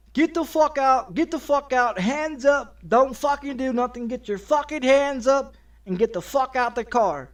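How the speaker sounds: retold officer shouting commands; cops yelling. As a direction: bark it like a cop with a gun drawn. Police yelling orders during an arrest.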